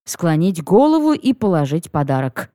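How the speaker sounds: ordinary delivery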